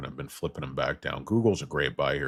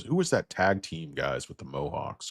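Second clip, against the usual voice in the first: ordinary talk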